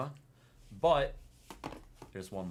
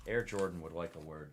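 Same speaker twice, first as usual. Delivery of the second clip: usual speaking voice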